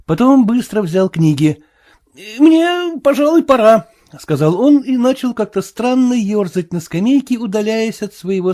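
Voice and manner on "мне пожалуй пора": high, louder, uneasy Marvel; nervous excuse. A narrator's reading. Marvel excusing himself from the sailor, nervous. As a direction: pipe up uneasily, eager to get away.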